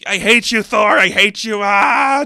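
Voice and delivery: high, loud hateful scream; villain screaming hatred at Thor. Acted. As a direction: scream it with all you have. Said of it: "just screaming at the top of his lungs".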